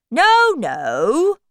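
high, loud protest; Hatter. Acted. Hatter denying the king's accusation, loud high protest. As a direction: protest loudly with a swooping voice. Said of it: "the Hatter said".

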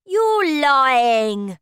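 high, loud accusing cry; cried out. Acted. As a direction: accuse him loudly. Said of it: "he cried".

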